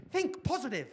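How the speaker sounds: light mock-cheerful Joy; Joy quoted. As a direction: chirp it like a relentless optimist. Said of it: "Joy's favourite line".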